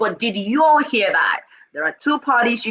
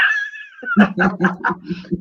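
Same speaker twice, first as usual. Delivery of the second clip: big laugh. Real, not acted. Host laughing hard while recalling meeting her.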